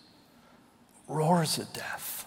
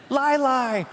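put-on high squeaky toddler voice calling lai; toddler imitation; 10 semitones higher than usual. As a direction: mimic the little son's excited chant. Toddler answering the lion video.

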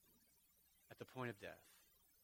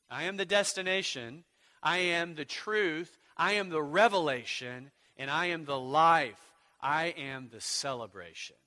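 louder, building list; preaching at full force; 2 semitones higher, 10 dB louder than usual. Acted. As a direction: hammer each I am louder.

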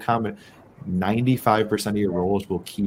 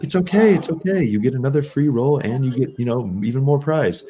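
much higher and louder, mock-sweet hustler voice; coaxing scammer; 6 semitones higher, 4 dB louder than usual. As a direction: sell it like a pushy con man soothing a mark. Mimicking the scam dealer's coaxing.

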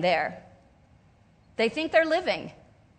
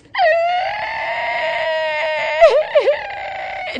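high, loud mock wail of a weeping bride; wailing bride sob; 6 dB louder than usual. Bride sobbing as she walks down the aisle, loud mock wail. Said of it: "you can't hear anything but her crying".

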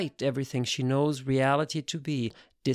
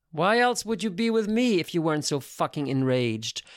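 plain reading voice

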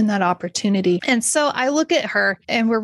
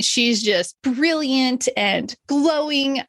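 normal talking voice

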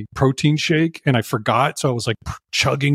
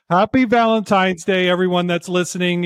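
usual speaking voice

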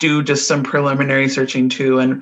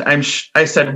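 ordinary talk

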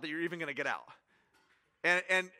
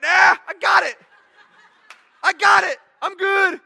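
loud, high mock shout of refusal; hammed-up yelling that you're fine; stubborn refusal; 11 semitones higher, 17 dB louder than usual. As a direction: people shouting God away. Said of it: "we're like".

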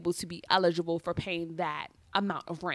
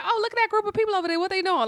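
curious inner voice; 11 semitones higher, much faster than usual. Retold or imitated. Inner thought seeing crowd.

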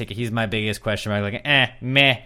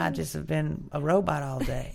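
imitating Sam; 6 semitones higher, 5 dB quieter than usual. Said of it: "she's the sympathetic robot girl now".

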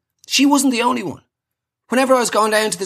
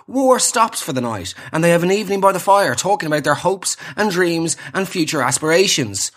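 usual speaking voice

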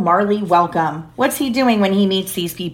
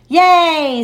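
high, louder happy cheer; cheering yay; 8 semitones higher, 5 dB louder than usual. A narrator's reading. Children cheering that Marley can stay, high glad yay. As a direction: cheer like a little kid. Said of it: "said baby".

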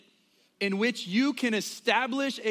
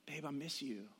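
much softer, tender missing-you; soft wife; 4 semitones lower, 14 dB quieter than usual. Retold or imitated. Wife in bed tells husband she misses him, much softer and tender. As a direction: lay it down gently, much softer. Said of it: "she'd go".

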